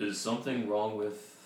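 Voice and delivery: silky-voiced orc shopkeeper asking with concern; smooth voice. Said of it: "like, smooth voice".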